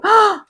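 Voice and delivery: sharp high gasp; shocked gasp. Real, not acted. Reacting to the line about the leg, gasp. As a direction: gasp in surprise.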